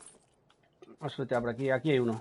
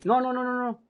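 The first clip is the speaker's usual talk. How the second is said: panicked no; 10 semitones higher than usual. Real, not acted. Panicked in gunfight.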